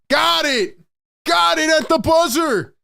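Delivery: high, excited buzzer call. Real, not acted. Fan calling the buzzer-beater to his chat, excited.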